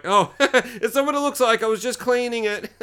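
high, laughing excuse; mock embarrassed excuse. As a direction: pretend you got caught, laughing it off. Jokey excuse to imagined watchers.

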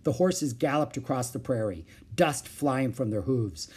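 ordinary delivery, nothing marked

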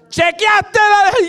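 high, slow call from another room; shouting from the bathroom. Retold or imitated. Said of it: "desde el baño me grita". (esposa grita desde el baño, aguda y lenta)

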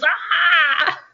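sharp high squawking Ah; a loud bird-like squawk of disapproval; imitated trainer squawk. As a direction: mimic the coach's sudden high squawk. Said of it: "like, squawks".

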